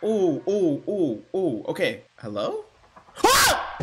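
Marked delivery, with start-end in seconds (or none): 3.2-3.8 sudden high yelp of fright; startled scream. Real, not acted. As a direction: jump and yelp at the scare. Startled by game scare.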